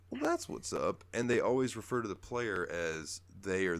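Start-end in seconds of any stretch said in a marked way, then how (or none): 0.1-1.0 jokey approval. Retold or imitated. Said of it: "And I'm like".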